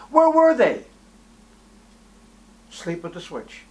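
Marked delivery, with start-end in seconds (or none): 0.1-0.8 high, loud cry of where were they; preacher's climactic shout; rising demand. Real, not acted. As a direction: fling the question out at the room.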